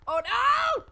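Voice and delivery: very loud, high shriek; startled scream. Real, not acted. Player grabbed from the dark in a horror game, startled scream. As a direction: scream it at a jump scare. Hand grabs the player's character.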